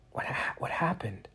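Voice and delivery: dazed whisper; unvoiced, groggy whisper. A narrator's reading. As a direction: barely voice the question. Max waking dazed.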